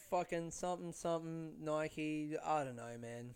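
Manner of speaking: ordinary talk, nothing marked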